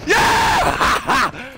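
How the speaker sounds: high, loud yell into laughter; exultant, laughing shout; triumphant cheer. Real, not acted. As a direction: scream in victory and crack up.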